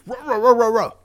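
very high loud barking; barking Chain Chomp. Acted. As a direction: yap it like a small dog. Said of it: "I'm like".

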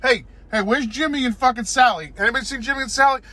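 high, loud squeaky little ant panicking; panicked ant voice. Acted. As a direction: squeal it like a frantic tiny ant. Ants voiced after being sucked in.

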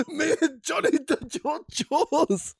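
high, slow, helpless laughter through the words; laughing so hard it cracks. Real, not acted. Laughing while recalling the story.

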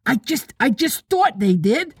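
put-on high boy bursting in; excited little-boy interruption. A narrator's reading. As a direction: pitch it up, tripping over the first words. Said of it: "Curly broke in excitedly".

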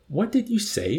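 high-pitched puzzled asking; innocent question. A narrator's reading. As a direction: ask it guilelessly, pitched high. Innocent Arfie asks, higher voice.

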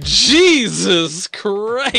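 drawn-out exasperated Jesus Christ; loud exasperated groan. Real, not acted. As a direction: groan it loudly and slowly. Reaction after dark scene.

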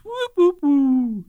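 high mock whistle sounds; slide whistle. Imitating a comedy sound effect, high whistling whoops. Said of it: "one of those four whistles".

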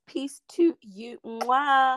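slow, drawn-out sign-off with a blown kiss; stretched-out theatrical goodbye and kiss; showy farewell. Acted. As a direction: linger on it like a diva.

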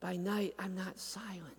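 hushed, breathless whisper. Acted. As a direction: let it fall to a bare whisper. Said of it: "where he says".

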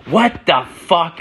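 high, drawn-out outrage; mock-shrieked disbelief; outraged wife. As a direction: imitate a wife storming in on the scene. Wife storms in, retold.

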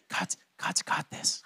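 slow, barely voiced whisper; mock timid whisper. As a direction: whisper it slowly, imitating a hushed congregation. Mimics quiet church's timid response.

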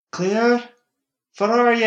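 high, loud call up the stairs; shouting. A narrator's reading. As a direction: shout for Claire from below. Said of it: "wifey was shouting".

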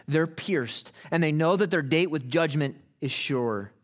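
ordinary talk, nothing marked

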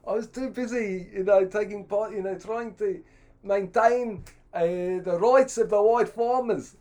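high, loud Rhodesian colonial; hammed-up indignant Rhodesian, spluttering and self-important; Rhodesian accent. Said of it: "he was like".

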